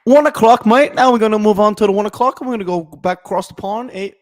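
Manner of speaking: loud, high-pitched put-on English accent; mock English accent. Host moves the show to one o'clock, in a loud mock English accent. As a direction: shout it as an over-the-top Englishman. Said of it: "I can't fucking talk like that".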